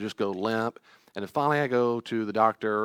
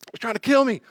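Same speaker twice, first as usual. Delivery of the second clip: much higher and louder, frightened accusation; panicked inner child; 12 semitones higher, 8 dB louder than usual. Retold or imitated. Preacher voicing the wounded child, panicked and high. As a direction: cry it out like a scared kid. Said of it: "the child thinks crazy things".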